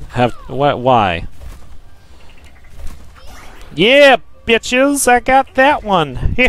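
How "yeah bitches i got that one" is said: gloating cheer. Real, not acted. Gloating after a kill.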